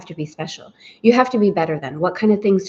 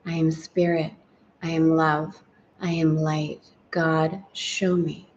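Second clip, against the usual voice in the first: guided affirmation; 3 dB quieter than usual. Acted. Leads affirmations and prayer.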